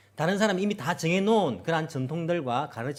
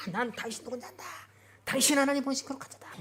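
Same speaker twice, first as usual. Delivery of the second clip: high, strained demon cry; demon yelling; 10 semitones higher, 3 dB quieter than usual. Acted. Possessed man crying at Jesus, high strained cry. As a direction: scream it like a cornered demon. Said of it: "큰 고함 치면서 막".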